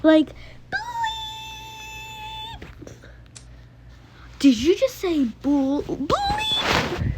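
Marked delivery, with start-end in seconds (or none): 0.7-2.7 squeaky taunt. Acted. Kid taunting with bleep. 5.8-7.1 squeaky taunt. Acted. Kid taunting with bleep.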